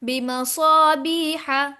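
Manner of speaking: बिमसाबीहा chanted slowly, सा and बी lengthened; measured बिमसाबीहा with a held long ee; long madd. Shown as a demonstration. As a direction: stretch the vowels of बिमसाबीहा. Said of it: "या मद्दा बनेगी".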